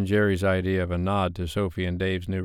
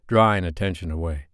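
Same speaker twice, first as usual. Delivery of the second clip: ordinary talk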